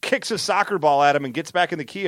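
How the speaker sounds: usual speaking voice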